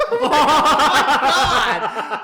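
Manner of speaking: high, drawn-out wail of disbelief; hammed-up shocked outburst; mock horrified shriek. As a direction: scream it through laughter. Reenacting shock at being fired.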